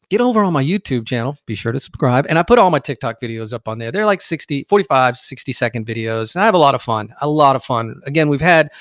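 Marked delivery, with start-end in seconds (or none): none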